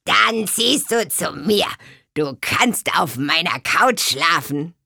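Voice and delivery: kindly hag asking; old swamp witch. A narrator's reading. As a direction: swamp witch aunt asks where she sleeps. Tante Müffel voiced.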